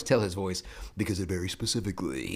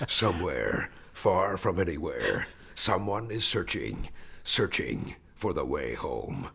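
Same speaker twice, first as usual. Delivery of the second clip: slow, deep epic robot narrator; Optimus Prime trailer voice; 3 semitones lower, slower than usual. Retold or imitated. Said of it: "Optimus Prime was the narrator".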